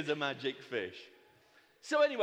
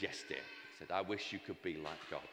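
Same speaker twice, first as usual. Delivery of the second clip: low, much softer resigned reply; meek husband; 10 semitones lower, 8 dB quieter than usual. Acted. Browbeaten husband granting his wife's wish, low and meek.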